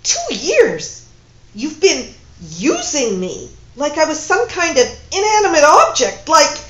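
loud, high outraged accusation. Acted. Woman accusing her lover of using her, loud outrage. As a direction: spit out the betrayal. Furious quarrel.

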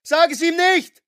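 put-on desperate young woman shouting; Julie screaming. A narrator's reading. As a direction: scream a warning at him. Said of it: "brüllte Julie".

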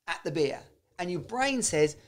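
ordinary talk

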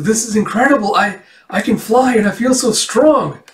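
high, excited rush; amazed excitement. Acted. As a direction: marvel at brand-new powers. Girl discovers her new powers.

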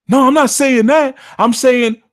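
very high, loud panicked pleading; mock panic. Caller begging the reader not to abandon him. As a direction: beg like you are falling into the abyss.

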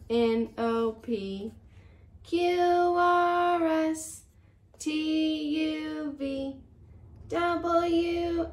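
lively storybook read-aloud; reading to children. Acted. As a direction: read it like a bedtime picture book. Reads picture book to children.

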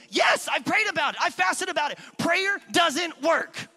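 high, loud acted-out outburst; exasperated shouting. Husband answering wife about prayer, re-enacted frustrated shouting. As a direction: yell back, slowing hard on the last words.

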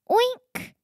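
high, squeaky little oink; piglet oink. Acted. Reading the farm story aloud, piglet oinks high and squeaky.

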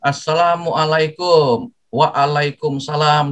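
slow, careful "Assalamualaikum" and its reply; proper Islamic greeting. Shown as a demonstration. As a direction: give the salam slowly and respectfully. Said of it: "ini kalau secara Islam ya begini". (salam diucapkan pelan dan santun)

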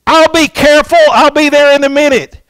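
put-on high, loud kid's shout; boy yelling back. Little Johnny shouting back to the neighbor, high and loud. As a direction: call back cheerfully from a distance. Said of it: "he yells back and says".

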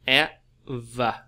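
"Ever" in two separate parts, first one strong; slow teaching model, E... ver; first-syllable stress. Shown as a demonstration. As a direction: break "Ever" apart, punching the E. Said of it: "nó sẽ là nhấn âm một".

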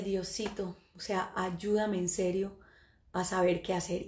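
soft, slowed little prayer; understated, low-voiced pleading; hushed plea. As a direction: quietly beg God for help, retold. Retold quiet plea to God.

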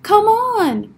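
high, loud beckoning call; calling over. A narrator's reading. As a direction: call a friend over excitedly. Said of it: "Bear waved to Mole".

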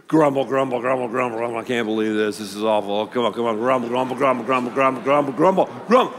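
leading the congregation in a low grumbling chant; grumbling demo. Pretend. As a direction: grumble grumble under your breath like a sulky crowd. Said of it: "Let's all grumble together right now".

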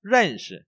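"rần" stressed, "shi" light. Shown as a demonstration. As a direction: lean on "rần", skim "shi". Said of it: "đọc như vậy".

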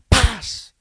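loud mock slam paz; door slam. Pastor retells the angry woman slamming the car door, loud slam noise.

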